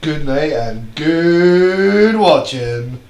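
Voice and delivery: very slow, loud announcer voice; announcer sign-off. Acted. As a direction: draw out the sign-off grandly. Show sign-off.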